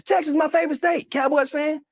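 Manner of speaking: cheerful high-pitched President impression; imitating the President. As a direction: put on a folksy high presidential voice. Retelling the President's lines.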